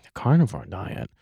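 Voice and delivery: low, quiet mock incredulity; puzzled echo. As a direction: echo it under your breath, baffled. Retold puzzled reaction to diners.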